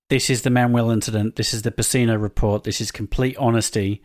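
scripted reveal read. Acted. Reads scripted mystery-guest bio.

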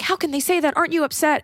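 high-pitched, indignant ally voice; mock outraged ally. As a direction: play the shocked well-meaning friend. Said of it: "you're like".

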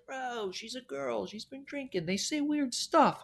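lightly voiced dismissive roommate; imitating roommate. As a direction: shrug it off like the roommate.

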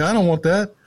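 put-on high, pleading child voice; imitating himself as a kid; scared kid. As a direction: whine it like a four-year-old. Kid refusing meat from dad.

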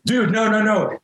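high-pitched, urgent backing-off; retold alarmed plea. Fifteen-year-old to classmate about to sprint at him, alarmed. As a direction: fend someone off, reenacted.

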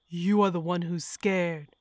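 cornered killer yelling back; killer's scream. A narrator's reading. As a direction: throw it back at her, loud. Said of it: "the Stow Lake killer screamed".

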